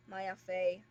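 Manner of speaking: ordinary talk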